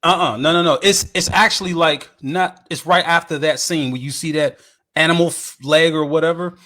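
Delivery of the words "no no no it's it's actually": ordinary talk, nothing marked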